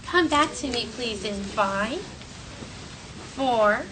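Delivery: usual speaking voice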